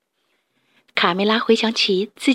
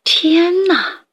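high, amazed exclamation; thrilled gasp; 7 semitones higher, 3 dB louder than usual. Acted. Rooster seeing the golden egg, high amazed cry. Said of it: "迪克激动地拿起鸡蛋端详".

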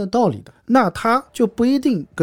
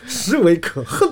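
实为可恨 with all four syllables hit hard and even; every word stressed; slower than usual. Shown as a demonstration. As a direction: punch each word of 实为可恨 separately, stern. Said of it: "全部都是重音". (实为可恨四个字都加重音)

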